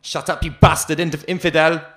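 the guard snarling back at the prisoner; guard's furious yell. Acted. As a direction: slam him down with rage.

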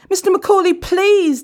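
high, loud frightened begging; desperate pleading. A narrator's reading. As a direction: beg for your life. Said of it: "Dan pleaded".

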